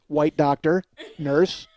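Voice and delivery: ordinary talk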